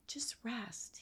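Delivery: soft whispered comfort; tender whisper. Retold or imitated. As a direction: breathe it out gently, like soothing a child. Jesus comforting her in vision.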